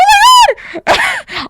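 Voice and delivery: very high-pitched mock shriek; retold scream. Daughter seeing dad newly shaved, imitated scream. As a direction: re-enact a startled scream without full volume. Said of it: "I turned around and I screamed".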